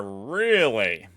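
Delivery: big mock-suspicious "really"; drawn-out questioning.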